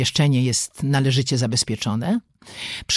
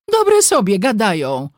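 high, scornful comeback; bickering retort; 7 semitones higher, 4 dB louder than usual. A narrator's reading. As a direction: throw it back at your sister. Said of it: "zaczęły się coraz ostrzej przegadywać". (kłótliwa, wysoka riposta)

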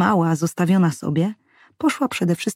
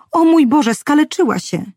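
alarmed cry; 9 semitones higher, 5 dB louder than usual. A narrator's reading. Mother sees child fall.

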